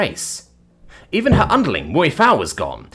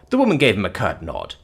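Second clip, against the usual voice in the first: plain reading voice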